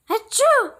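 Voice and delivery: high loud acted sneeze; big sneeze. A narrator's reading. Cheerleaders sneezing on the field, high loud sneeze. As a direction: let the sneeze explode. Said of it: "repeated the fans".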